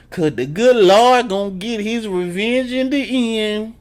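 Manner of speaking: put-on high, slow pious old lady voice; mock churchy mama. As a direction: lay the good Lord on thick, high.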